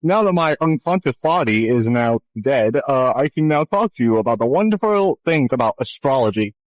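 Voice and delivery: ordinary talk, nothing marked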